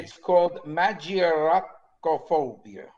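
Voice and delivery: slow Italian-flavoured "magioracophobia"; Italian accent. Shown as a demonstration. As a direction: roll "magioracophobia" like an Italian word. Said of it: "Notice I put the Italian accent in there".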